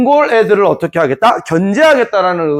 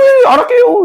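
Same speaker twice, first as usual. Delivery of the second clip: high, squeaky mock refusal; put-on timid little voice; meek backing down; 4 dB louder than usual. Noble declining another revolt, high and meek.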